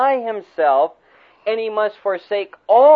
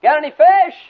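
high, loud hail across the water; a loud shouted hail from shore; calling out; 9 semitones higher, 8 dB louder than usual. Retold or imitated. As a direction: call out to the boat from the shore. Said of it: "this person yells out".

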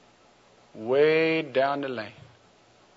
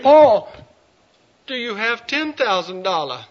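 Michelle, high; 7 semitones higher than usual. Acted. Michelle lowers her price again.

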